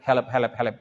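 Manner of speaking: ordinary talk, nothing marked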